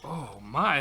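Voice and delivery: plain reading voice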